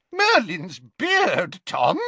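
high, loud, drawn-out exclamation; shocked yelp. A narrator's reading. Professor horrified by pupil's idea, shocked yelp. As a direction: yelp it in astonishment. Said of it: "yelped Slughorn".

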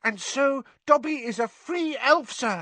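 very high, squeaky elf voice; excited house elf. A narrator's reading. Dobby tells Harry of his pay, squeaking. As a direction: squeak proudly like a little elf.

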